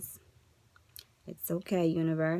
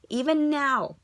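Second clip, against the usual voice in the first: rising louder each time; 1 building emphatic repeat; 9 semitones higher, 5 dB louder, slower than usual. Shown as a demonstration. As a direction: push harder on each repeat. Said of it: "Even now, even now".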